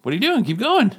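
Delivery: high, teasing back-and-forth; playful deferring. Real, not acted. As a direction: push the turn back at him, grinning. Teasingly passing the turn back.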